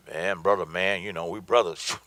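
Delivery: low-pitched, buddy-to-buddy appeal; chummy plea. Acted. Salesman to a fellow brother instead of pitching, chummy plea. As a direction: imitate a salesman leaning on brotherhood, voice dropped low.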